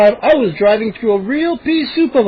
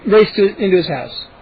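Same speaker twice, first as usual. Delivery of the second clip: usual speaking voice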